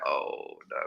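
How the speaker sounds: breathless, soft oh no; hushed unvoiced oh no; whispered dismay. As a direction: gasp it under your breath in dismay. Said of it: "I'm like".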